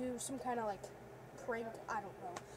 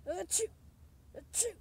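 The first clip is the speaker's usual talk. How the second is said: fake sneezes; 4 semitones higher than usual. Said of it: "sneezing doctor".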